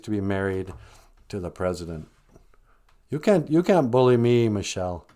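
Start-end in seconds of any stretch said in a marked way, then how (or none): none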